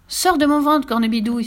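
put-on child crying out in protest. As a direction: order her out loudly. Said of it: "s'écria Pierre".

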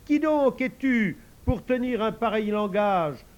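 slow, high, loud declaiming; boy playing the knight; lofty recited challenge. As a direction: proclaim the line fully. Joe recites the line properly.

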